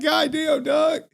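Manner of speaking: high, stunned exclamation; mock stunned. Streamer reacting to the roast, high and stunned. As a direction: gasp it out like he got burned. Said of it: "I was like".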